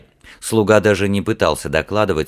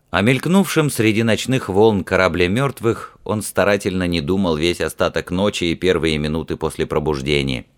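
ordinary delivery

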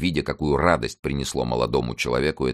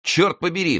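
high-pitched exclamation of indignation; angry cry; 3 dB louder than usual. A narrator's reading. Musketeer cutting off a cowardly lackey, angry cry. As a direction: cry out the curse, pitched well up. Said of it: "вскричал Д'Артаньян".

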